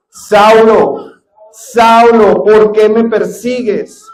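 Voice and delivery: loud, commanding call of the Lord; the Lord calls Saul from the sky, loud and commanding; booming voice from heaven. Acted. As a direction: call out his name like thunder from above. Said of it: "Oyó una voz que le decía".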